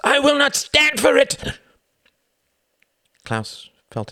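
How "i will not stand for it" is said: high, loud furious shout. Acted. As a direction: yell it at the count in outrage. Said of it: "shouted Klaus".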